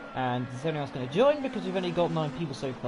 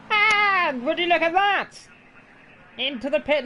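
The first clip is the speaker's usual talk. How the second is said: much higher, louder, slow showman commentator; milk the moment like a TV race caller; hammed-up announcer marvel; dramatic commentator; 12 semitones higher, 6 dB louder than usual. Mock commentator exclamation.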